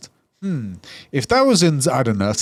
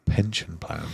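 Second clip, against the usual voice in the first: breathy whispered aside; whispering; 6 semitones lower, 7 dB quieter than usual. Real, not acted. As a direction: whisper the words secretly. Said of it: "we're all whispering".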